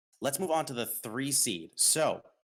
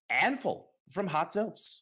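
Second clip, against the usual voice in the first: hyped announcement; 9 semitones higher, slower than usual. Acted. Announcing first-place vote winner.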